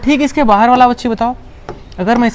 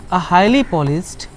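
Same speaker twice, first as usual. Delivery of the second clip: slow and a bit lower; slow dictation; 4 semitones lower, 7 dB quieter, much slower than usual. Shown as a demonstration. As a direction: dictate so they can write. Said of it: "dictating definition". (धीरे-धीरे बोलकर लिखवाना)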